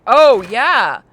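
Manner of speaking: ordinary delivery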